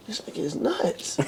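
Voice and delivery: hushed, high aside with a laugh; slow, soft, as if only thinking it; incredulous inner thought. Retold or imitated. Retold private thought, said in head.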